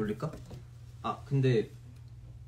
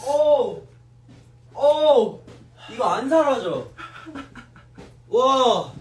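high loud yelling; battle roar; 10 semitones higher, 7 dB louder, much slower than usual. Real, not acted. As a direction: let out big loud yells. Sour candy hits, loud reaction.

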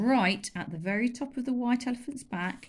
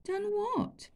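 high, wide-eyed innocence; innocently; 14 semitones higher than usual. Acted. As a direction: play dumb sweetly. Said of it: "said Chulak innocently".

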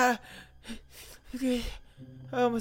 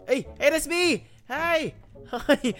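amused greeting; 9 semitones higher, 4 dB louder, slower than usual. Real, not acted. Greeting his own creepy avatar.

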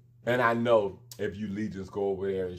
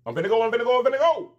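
playful high rush off; 11 semitones higher, 6 dB louder, much faster than usual. Real, not acted. Playfully rushing off.

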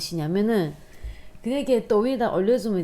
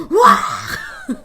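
laughing whoop of joy; excited shout; 6 dB louder than usual. Real, not acted. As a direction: shout with delight at the win. Jackpot win reaction.

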